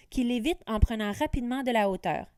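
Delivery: plain reading voice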